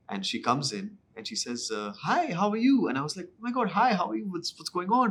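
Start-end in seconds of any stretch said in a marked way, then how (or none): 2.0-2.9 high singsong retold hello; high-pitched imitated hi; imitated greeting. Director greets actor on set. 3.3-5.1 high, gushing retold greeting; mock-flustered high-pitched hello; wired, anxious. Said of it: "I was so wired".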